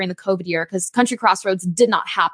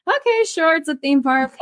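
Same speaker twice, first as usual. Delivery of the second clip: mock unimpressed; 8 semitones higher than usual. Mock-unimpressed retold reaction.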